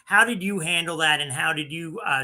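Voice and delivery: ordinary delivery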